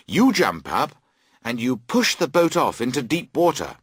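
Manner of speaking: ordinary delivery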